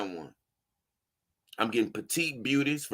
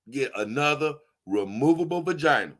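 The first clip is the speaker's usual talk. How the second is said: ordinary delivery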